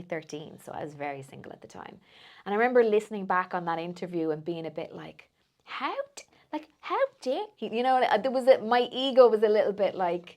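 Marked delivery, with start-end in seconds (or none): 5.6-7.7 high, offended how dare he; mock indignation. Retelling her ego's reaction to the advice, high mock-offended. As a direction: squeak out mock outrage.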